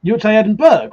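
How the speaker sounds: mock American saying Edinburgh as burg; imitating an American. Streamer mocks how Americans say it, Edinburgh as Edin-burg. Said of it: "I'm making butchery of this".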